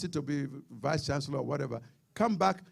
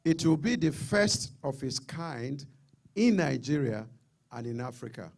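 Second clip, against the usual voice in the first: slow, deliberate formal read-out; reading very slowly; 2 semitones lower than usual. Real, not acted. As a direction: read it out slowly, phrase by phrase, so listeners get it. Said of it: "I'll read very slowly here".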